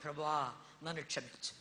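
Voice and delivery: whisper-soft repentant prayer; murmured prayer for forgiveness; soft prayer demo. Shown as a demonstration. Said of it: "చిన్న ప్రార్థన చేయి".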